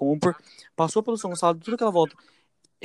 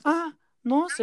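mock surprise; 10 semitones higher, much slower than usual. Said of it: "Aí ela falou bem assim".

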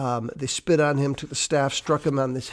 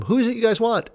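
high, loud mock question; playful acted question; 9 semitones higher, 5 dB louder, faster than usual. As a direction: ask it loudly, pitched way up, in play. Acting Jesus's question to the arresters.

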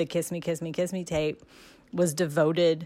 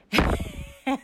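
breathy giggle; 8 semitones higher than usual. Real, not acted. Laughs while telling story.

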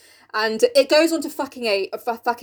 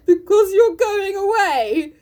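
high, drawn-out tearful wail; mock sob; 7 semitones higher, 4 dB louder, much slower than usual. Jo answering the departing bear, in a mock sob. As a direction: cry it out slowly, hammed up. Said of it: "answered with an irrepressible sob".

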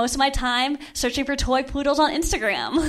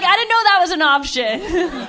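sarcastic; 5 semitones higher, 4 dB louder than usual. Retold or imitated. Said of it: "I'm like".

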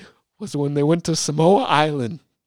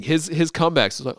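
ordinary talk, nothing marked

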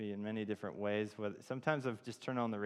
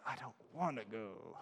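reluctant; 5 dB quieter than usual. Retold or imitated. Young man fighting God's call in his heart, reluctant. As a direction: drag it out like someone resisting inwardly. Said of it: "still just fighting it in my heart".

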